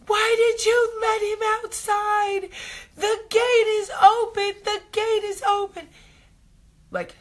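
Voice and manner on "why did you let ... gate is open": put-on much higher, louder wailing child's voice; imitating hysterical crying. Mom retelling her daughter crying over the open gate, high wailing. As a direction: wail it like a panicked little girl. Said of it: "hysterical crying".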